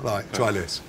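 half-whispered aside; retold confiding tip. Drinker recommending a snack to a friend, confiding. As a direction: lean in and share it under the breath. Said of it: "they're talking to their friend going".

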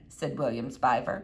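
ordinary delivery

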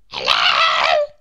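tiny character yelling full out; long, straining little-voiced shout; shout at the top of voice. A narrator's reading. Mister Small tries to reach Mister Tall, shouting. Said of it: "shouted at the top of his voice".

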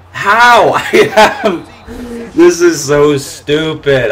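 high, loud cry, half laughing; outraged disbelief. Real, not acted. Gamer furious after missing the field goal. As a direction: yell it in laughing outrage, then drag the last words slowly. Gamer reacts to missed kick.

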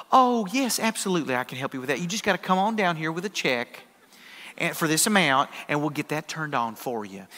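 soft-spoken, sweet Arkansas gal; Arkansas accent. Retold or imitated. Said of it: "sweet little gal with an Arkansas accent".

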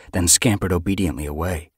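plain reading voice

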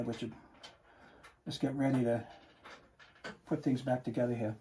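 talking with screws held in mouth. Real, not acted. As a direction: mumble around a mouthful of screws. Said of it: "I'm just gonna put a couple of screws in my mouth here".

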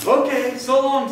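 high, loud storybook cheer; cheerful. A narrator's reading. Tumbleweed leaving grumpy cactus, said cheerfully. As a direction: sing out a cheery farewell. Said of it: "said Rosie cheerfully".

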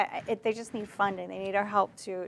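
ordinary talk, nothing marked

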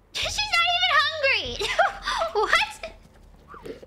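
high, laughing she's not even hungry; laughing disbelief. Real, not acted. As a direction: cry it out through laughter.

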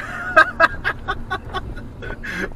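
high laugh. Real, not acted. Laughing at himself.

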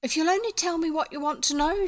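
high, lilting character voice; girl's coaxing. A narrator's reading. Elfrida asks her questioners to explain, high lilting plea.

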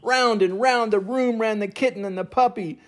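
usual speaking voice

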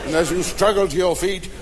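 usual speaking voice

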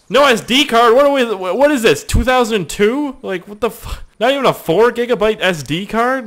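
high, loud indignant rant; outraged disbelief. Retold or imitated. Customer ranting about the missing SD card, outraged.

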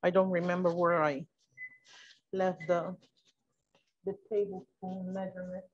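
low, soft self-talk while searching; talking to oneself. Real, not acted. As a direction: think aloud quietly, half to yourself.

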